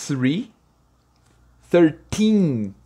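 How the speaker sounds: put-on thirteen with a long drawn-out teen; stretched teen. Shown as a demonstration. Teacher drawing out the teen for students. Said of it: "Você dá essa esticada no teen".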